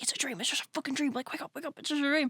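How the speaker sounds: quick, high, hushed self-reassurance; mock panicked self-talk. Slapping yourself awake as Freddy runs at you, mock panic.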